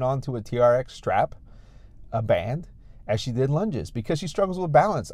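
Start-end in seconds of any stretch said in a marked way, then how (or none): none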